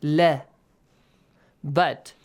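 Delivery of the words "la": "La" with a light, thin a sound; light lam. Shown as a demonstration. Teacher showing students the light pronunciation, light lam. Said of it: "pronounce it with an a sound".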